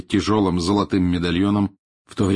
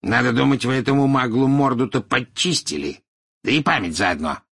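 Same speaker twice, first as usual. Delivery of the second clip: challenging, raised then dropping off; defiant; 5 semitones higher, 4 dB louder than usual. A narrator's reading. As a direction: carry on the challenge dismissively. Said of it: "с вызовом спросил Мракс".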